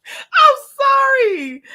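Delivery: high, loud apology through helpless laughter; laughing apology. Real, not acted. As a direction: squeal out sorry while cracking up. Apologizing while laughing at herself.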